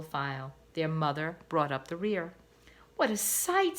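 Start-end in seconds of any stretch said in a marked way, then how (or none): 3.0-3.8 awed. A narrator's reading. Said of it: "Sam said to himself".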